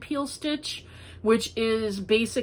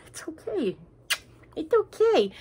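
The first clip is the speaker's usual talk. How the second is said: soothing pet talk; 8 semitones higher than usual. Real, not acted. Soothing the dog.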